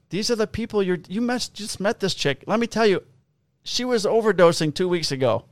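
officer warning a man about the woman he just met, exasperated; retold exasperated lecture.

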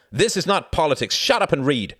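loud, high barked command; angry order. A narrator's reading. Jorgen orders reluctant Klaus to read, angry shout. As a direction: shut the objection down at full volume.

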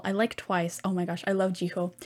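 ordinary talk, nothing marked

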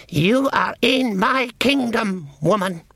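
high, slow, cold command; a regal elf queen looking down on her; evil queen. A narrator's reading.

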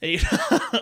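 laugh. Real, not acted. Laughs at "hell yeah.

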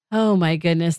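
usual speaking voice

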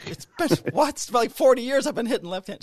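squeaky mock outrage at giving up the lefty swing; exasperated whine. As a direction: whine that it can't be over after all this time. Exasperated over lost lefty swing.

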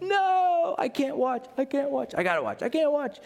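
half-laughing panic of a horror fan; mock scared viewer. Viewer fretting at a scary movie scene, hammed-up dread.

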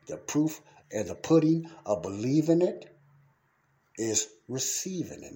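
rising lead-in, then a weighty "receiving" after a pause; emphatic stress. Real, not acted. Preacher repeating the point for emphasis, stressing "receiving". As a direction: build up high and loud to "believing it", pause, land "receiving". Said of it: "but I put emphasis on that".

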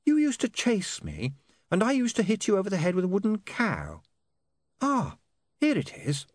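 put-on high, hesitant young lady; lightly voiced upper-class girl; Saccharissa voice. A narrator's reading.

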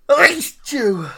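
loud real sneeze; a sudden loud sneeze. Real, not acted. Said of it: "I'm sorry for sneezing".

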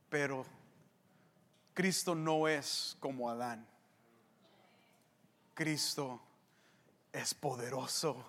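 low, soft, with long pauses; quiet, weighty preaching; hushed solemn build-up. Real, not acted. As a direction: hold back gravely before the climax. Preacher building to triumphant climax.

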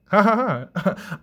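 high laugh falling away. Real, not acted. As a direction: burst into a short, high ha-ha. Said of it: "I'm laughing now, too".

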